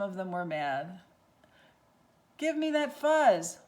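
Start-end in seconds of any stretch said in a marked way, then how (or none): 2.4-3.6 demanding prairie dog. Acted. Prairie dogs fighting over fuzz.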